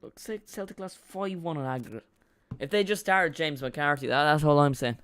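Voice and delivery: lightly put-on Scottish pundit accent. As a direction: slip into a jokey Scottish accent. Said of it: "Don't know why I did a Scottish accent".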